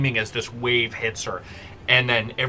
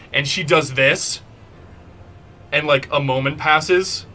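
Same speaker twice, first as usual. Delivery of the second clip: ordinary talk, nothing marked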